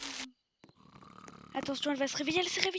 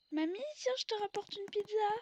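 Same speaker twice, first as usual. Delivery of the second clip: high, sing-song offering to granny; playful sweet voice; 8 semitones higher than usual. Pretend. (voix aiguë et mielleuse)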